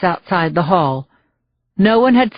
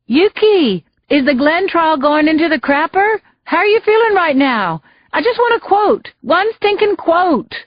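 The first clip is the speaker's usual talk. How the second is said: high, pushy reporter calling after her; a reporter hounding her down the steps, calling out high and insistent; 8 semitones higher than usual. A narrator's reading. Reporter calls after Yuki down the courthouse steps, high and pushy. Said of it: "The reporter called after her".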